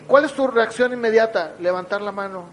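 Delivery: usual speaking voice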